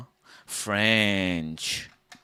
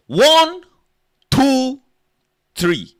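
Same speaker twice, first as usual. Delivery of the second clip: high loud yelped count; mock Pidgin counting; 15 dB louder, much slower than usual. Comic counting in Pidgin, high loud yelps. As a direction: squeal one two three, falling on three.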